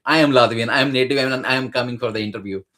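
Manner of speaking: a lightly hammed-up rush of words; mock fast talk. Mocking the Latvian examiners who speak too fast, quick and loud. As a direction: rattle it off quickly, imitating the examiners. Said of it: "sometimes they speak very, like, very fast".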